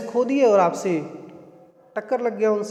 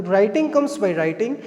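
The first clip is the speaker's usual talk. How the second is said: slow model phrase; much slower than usual. Shown as a demonstration. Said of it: "तो".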